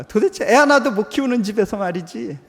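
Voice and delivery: self-reproach. Retold or imitated. Wife berating herself over the child, loud exasperation. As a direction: scold yourself in frustration. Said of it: "자책감이 드는 거예요".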